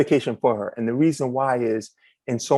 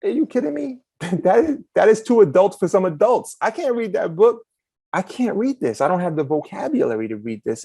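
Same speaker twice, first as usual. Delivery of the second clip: disbelieving outburst; 7 semitones higher than usual. Retold or imitated. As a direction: retell your kid self's shock, pitched high. I was like.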